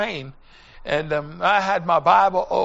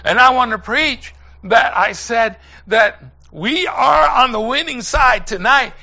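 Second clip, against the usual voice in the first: loud, rising preacher's cry; full-force preaching; 4 semitones higher, 8 dB louder than usual. Acted. Preacher calls brethren and sisters to the winning side, shouting. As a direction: drive it home to the congregation.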